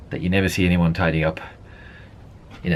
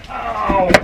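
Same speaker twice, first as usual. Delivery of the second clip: pained yelp; 12 semitones higher than usual. Real, not acted. Pain cry, burned.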